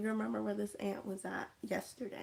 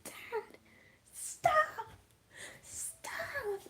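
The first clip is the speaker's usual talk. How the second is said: put-on high, frantic child's cry; a child shrieking for his parents to stop; pleading kid; much slower than usual. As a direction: beg them to stop like a scared kid. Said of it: "the kid was like".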